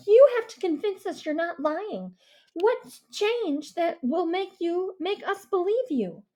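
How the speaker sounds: put-on stern girl's challenge; commanding girl. A narrator's reading. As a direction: Mia taking charge. Mia voiced by narrator.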